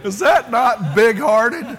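laughing through the question, slow. Real, not acted. Pastor laughing to the congregation about the coach. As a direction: ask it while still cracking up. Laughing at his own story.

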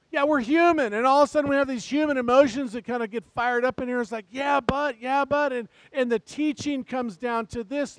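full-force pulpit preaching; fired-up preaching. Acted. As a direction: preach it out to the whole church.

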